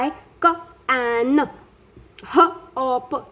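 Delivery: mock child reading "क, ए, न" as separate letter sounds instead of whole words; imitating sound-by-sound reading. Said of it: "बच्चे reading के time पे भी बोलने लग जाते हैं".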